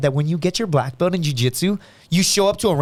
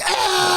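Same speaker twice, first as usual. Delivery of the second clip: high, drawn-out yell; mock group cheer. Gym mates react to the new guy's "yeah", high mock yell. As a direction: imitate a whole crowd erupting at once.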